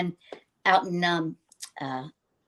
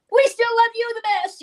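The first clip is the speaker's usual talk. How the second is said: imitating the fans; 13 semitones higher, 4 dB louder than usual. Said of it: "I go".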